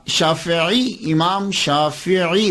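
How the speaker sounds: شافعی very slowly drawn out as "shaa-fi-'ee"; slow-motion "shaa-fi-'eee" for شافعی; laboured correct pronunciation. Shown as a demonstration. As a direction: painstakingly stretch شافعی, short I after F and throaty ayn. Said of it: "دیکھا نا بہت مشکل لگ رہا ہے".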